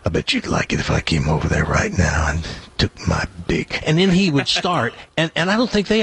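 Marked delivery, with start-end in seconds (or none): 0.0-3.8 much lower, crooning late-night newsman; deep newsman voice. Retold or imitated. Newsman on the phone sweet-talking women, very low croon. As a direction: purr it down low like a sleazy radio baritone. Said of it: "It was beyond deep".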